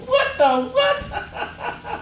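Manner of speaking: high, drawn-out giggling question; laughing. Real, not acted. Comedian cracking up on stage, slow giggling question. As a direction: stretch it slowly, lost in laughter. Laughing at his own joke.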